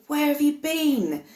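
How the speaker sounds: begging a lost aunt, half in tears; retold tearful plea. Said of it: "And I was crying".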